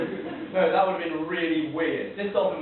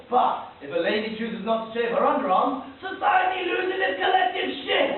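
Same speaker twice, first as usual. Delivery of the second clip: mock outrage; 6 semitones higher, 4 dB louder than usual. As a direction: rant building to a high shout. Mock outrage at society's double standard.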